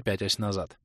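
plain reading voice

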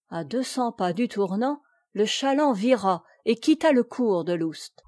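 plain reading voice

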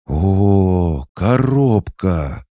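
high, slow, delighted gasp; thrilled at the box; overjoyed. A narrator's reading. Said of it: "счастью кошки не было предела".

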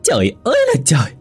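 high, quick exasperated wail; acted high-pitched angry outcry; screeching outrage. A narrator's reading. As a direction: screech it like a fed-up wife. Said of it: "Mụ Hoa gào lên".

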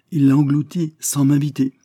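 triumphant captain. Acted. Captain crowning his general's boast, triumphant. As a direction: land the boast proudly. Daubenton's punchline.

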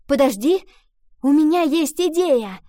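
loud, high sudden excitement; excited exclaim. A narrator's reading. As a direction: burst out with a bright idea. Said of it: "Вдруг воскликнула Ива".

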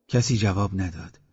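ordinary delivery

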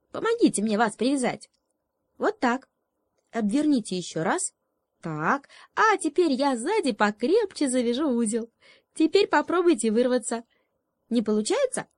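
high, fast little frog voice; a sly little frog ordering them about. Acted. Frog voiced, tying up animals. (высокий быстрый голосок лягушонка)

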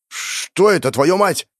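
startled high outburst; shocked swearing. Acted. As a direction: blurt it out in shock. Covered in flying flesh.

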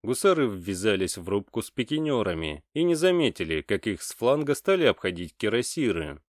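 usual speaking voice

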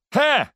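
sharp high scoffing skull cackle; a loud mocking high "Ha"; clattering jaw cackling skull. A narrator's reading. Dice-eyed skull scoffs. (резкий высокий смешок черепа)

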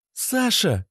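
high-pitched female call; woman calling. Acted. Lena wants Sasha's attention, high-voiced call.

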